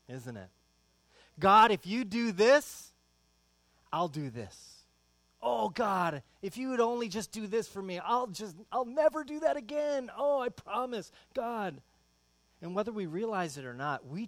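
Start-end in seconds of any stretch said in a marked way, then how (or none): 1.4-11.8 loud, high desperate begging; hammed-up desperate pleading to God; pleading bargaining prayer. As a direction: beg God like a whining child.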